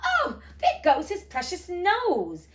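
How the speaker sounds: high, loud, slow wail of fright; terrified cry. A narrator's reading. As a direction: cry out as the saucepan flies past. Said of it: "in an agony of terror".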